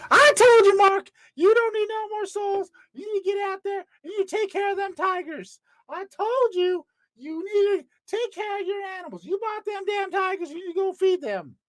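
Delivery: a Southern wife nagging about the tigers. Acted. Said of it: "I don't know why she's southern".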